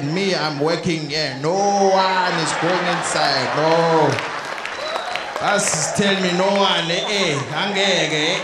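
put-on slow, heavy-accented African doorman; exactly who she expects. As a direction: refuse entry in long, drawn-out words. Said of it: "be exactly who she thinks I am".

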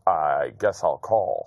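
low, slow, drawn-out deliberation; imitated reluctant opponent. Poker opponent slowly decides to call, low imitated voice. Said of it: "and he goes".

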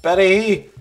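loud call; shouting a name. Real, not acted. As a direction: shout after her. Calling out.

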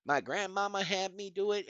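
lightly voiced imitation of a know-it-all viewer; mocking a viewer. Mocking viewers who would email him, a light put-on voice. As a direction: parody the eager fan who emails in. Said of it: "do not call me talking about".

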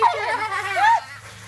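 loud high laugh from cold; squealing laugh, soaked and freezing; shrieking laugh. Real, not acted. Ice water just poured over her.

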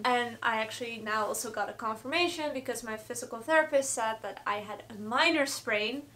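normal talking voice

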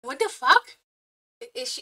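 ordinary delivery

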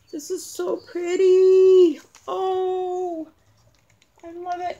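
high-pitched, drawn-out gushing; gushing delight. Real, not acted. Thanking Bobby Joe for a pretty gift, gushing delight. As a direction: coo with delight over a gift.

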